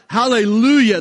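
loud, high shout of praise; multitude's triumphant hallelujah; crying out. Acted. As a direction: ring out the cry over the crowd. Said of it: "they cried out".

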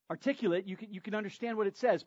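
usual speaking voice

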